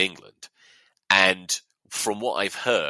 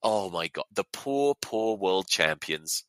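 ordinary delivery

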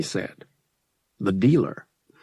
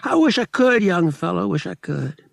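a touch of regret in a higher gambler voice; regretful; 11 semitones higher, 5 dB louder than usual. Acted. Lyle turning down Han's spice, regretful. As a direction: let him down gently. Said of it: "sounding regretful".

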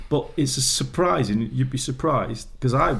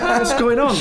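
laughing mock bafflement; 12 semitones higher, 5 dB louder than usual. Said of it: "It's like".

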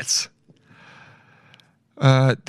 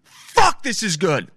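excited shout; 9 semitones higher, much slower than usual. Retold or imitated. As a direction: blurt it out, overwhelmed by taste. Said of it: "I'll go".

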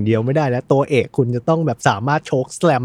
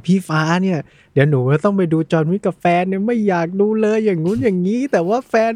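higher, playful imitation of a younger girl; imitating Im; 7 semitones higher than usual. Host retells Im's complaint to friends, high girlish voice. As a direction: mimic a coworker's girly, whiny complaint. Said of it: "อิมบอกว่า".

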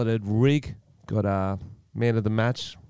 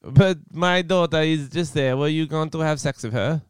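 a hint of Borat, pitched up; easy Borat interrogation; lightly voiced Borat; 6 semitones higher than usual. Borat questioning Giuliani.

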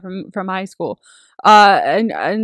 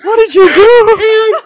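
horrified; 11 semitones higher, 8 dB louder, much slower than usual. Real, not acted. Friend reacts in horror.

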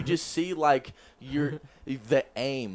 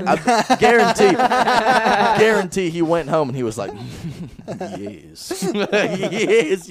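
gloating laugh; 5 semitones higher, 5 dB louder, slower than usual. Retold or imitated. Boss gloating over cheap rehire.

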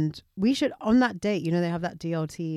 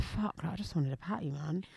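muttered disappointment; 13 dB quieter than usual. Retold or imitated. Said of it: "So I'm like".

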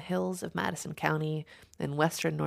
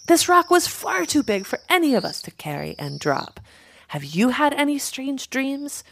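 higher-pitched, insistent questioning; urgent crow; 8 semitones higher, 4 dB louder than usual. A narrator's reading. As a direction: plead with the flock for answers.

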